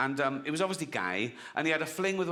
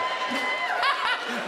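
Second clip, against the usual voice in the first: big high laugh; burst of laughter. Real, not acted. Laughing hard at his own punchline. Crowd laughing at pedo joke.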